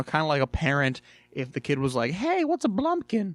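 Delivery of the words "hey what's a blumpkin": high-pitched mock kid's question; imitated curious kid. Kid asks parent an awkward question, high mock child voice. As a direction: pipe up high like an asking child.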